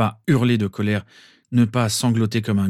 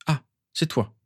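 cold; 5 semitones higher, 3 dB quieter than usual. A narrator's reading. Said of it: "dit-elle d'un ton froid".

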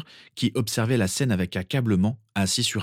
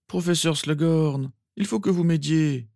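higher, urgent hoarse plea; voix rauque; 9 semitones higher than usual. Acted. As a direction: demand help in a lightly roughened voice. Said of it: "déclara-t-il d'une voix rauque". (supplique légèrement rauque)

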